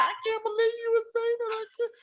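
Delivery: high, fast, whiny fake sob; mock crying wife. Wife shocked at her husband, mock sobbing. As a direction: ham up the wronged wife's wail.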